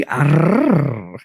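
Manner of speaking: Arrrr with a long trilled rolled R. Shown as a demonstration. A viewer asks her to roll her R's, a long trilled Arrrr. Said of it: "Roll them R's, baby".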